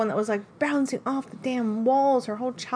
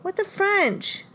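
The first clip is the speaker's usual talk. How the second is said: slow, higher disbelief; surprised; 7 semitones higher, much slower than usual. Real, not acted. Player told she guessed wrong, slow shocked outcry. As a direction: draw it out in shock.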